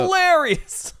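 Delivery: very high, put-on squeal; mock hilarity. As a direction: squeal it as a joke. Joking reaction to the punchline.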